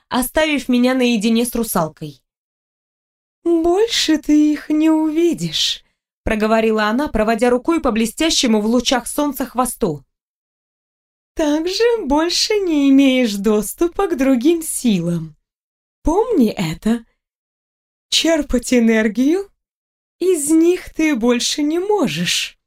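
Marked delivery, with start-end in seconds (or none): 3.4-5.8 высокий, неспешный голос русалки; скажи повыше и нараспев; ундина. A narrator's reading. 11.3-22.6 певучий, повыше обычного голос русалки; предупреди неспешно, нараспев; ундина. A narrator's reading. Играет ундину, водную элементаль.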